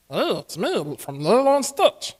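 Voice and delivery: slow, strained back-of-throat creature voice; Stitch. Shown as a demonstration. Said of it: "then you strain it to your".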